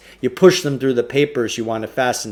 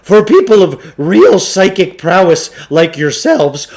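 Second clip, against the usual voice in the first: higher, louder salesman's patter; Samuel Klugarsh voice; 9 semitones higher, 10 dB louder than usual. Acted. Shopkeeper pushing a trade-in on the boys, higher, louder salesman voice. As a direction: sell it like an oily bookshop owner.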